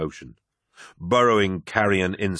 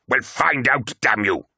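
raised, exasperated command; angry order; 5 semitones higher than usual. Acted. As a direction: snap it at the crew. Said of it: "ordered Turnit".

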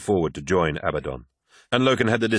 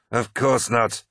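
higher-pitched, mild sneer; 4 semitones higher, slower than usual. A narrator's reading. Targost answers Loken's accusation, mildly sneering. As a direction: dismiss it with a touch of scorn. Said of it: "sneered Targost".

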